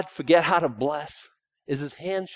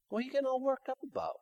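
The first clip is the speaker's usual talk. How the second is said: high, fast incredulous scold; soft, high, quick rebuke; mock scolding; 10 semitones higher, 10 dB quieter, faster than usual. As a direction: chide them for fretting. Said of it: "rebuking the worrier".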